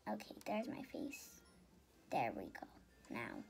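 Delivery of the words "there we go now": whisper. Real, not acted. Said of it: "whispered aside".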